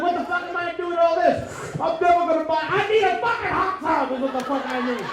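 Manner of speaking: high, frantic self-questioning; exasperated yelling. Acted. Acts out frantic coupon buyer.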